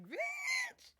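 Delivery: squeaky falsetto bitch; high mock squeal. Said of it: "They will be like".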